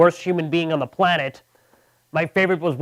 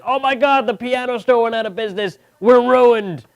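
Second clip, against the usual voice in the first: high, loud, panicked townsfolk; mock panic; 6 semitones higher, 4 dB louder than usual. Townspeople panicking over the piano store, high and loud. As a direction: overreact like the world just ended. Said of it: "They're like".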